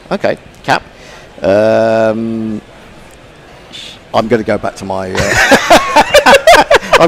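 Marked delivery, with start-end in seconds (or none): 5.2-6.9 embarrassed laugh. Real, not acted. Laughs at own failure.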